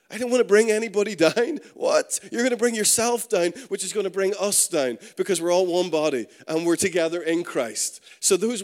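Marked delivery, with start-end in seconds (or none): 0.1-3.5 louder and higher, rising in disbelief; mock incredulous. Preacher repeating words he hates, mock incredulous. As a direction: throw the excuse back, half laughing, appalled.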